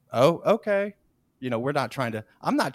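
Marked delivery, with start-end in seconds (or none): 0.1-0.9 bright, louder mock surprise; a raised, startled okay; surprised okay. Trainees answering the gloomy stranger, surprised. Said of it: "we're like".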